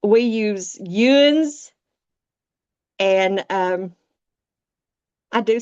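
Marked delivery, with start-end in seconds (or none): 0.8-1.7 "yous" drawn out slowly as a dialect word; Appalachian dialect word. Shown as a demonstration. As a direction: show off Appalachian "yous", stretched out. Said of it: "Appalachian area. We use yous".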